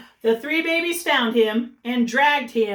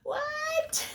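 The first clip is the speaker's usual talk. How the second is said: surprised squeak; 4 dB quieter than usual. Real, not acted. Surprise at picture.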